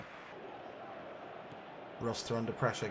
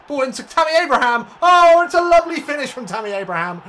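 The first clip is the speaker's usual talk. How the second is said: much higher and louder, shouting the goal; excited goal call; 12 semitones higher, 14 dB louder than usual. Real, not acted. Calling a goal for viewers, excited shout. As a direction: erupt as the ball hits the net. Commentating a goal being scored.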